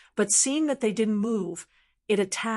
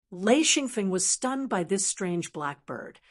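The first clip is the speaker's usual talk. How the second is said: ordinary delivery, nothing marked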